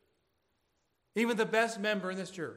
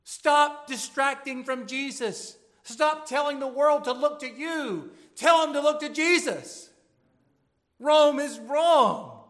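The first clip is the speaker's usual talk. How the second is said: slow, much louder and higher preaching yell; scream at the Vatican; 8 semitones higher, 11 dB louder, slower than usual. Acted. Pastor crying out against Rome, slow and much louder. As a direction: hammer each word out as if shouting at Rome. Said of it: "That's what I want to scream at the Vatican".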